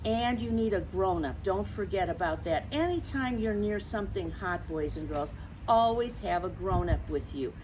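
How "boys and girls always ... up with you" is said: usual speaking voice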